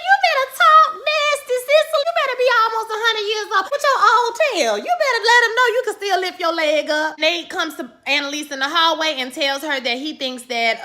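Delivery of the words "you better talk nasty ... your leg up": high mock scream, teasing her mother; squealing at mama to talk nasty; shrieked teasing. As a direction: shriek it at her like a scandalized daughter.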